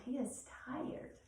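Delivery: ordinary talk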